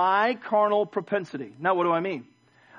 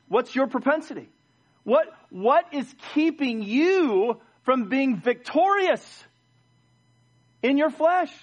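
high, slow, searching question; challenging preacher; 7 semitones higher, 4 dB louder, slower than usual. Acted. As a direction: confront the congregation with drawn-out emphasis.

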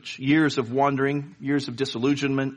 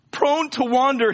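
tearful plea; 11 semitones higher, 4 dB louder than usual. Acted. Tearful poet quoting himself.

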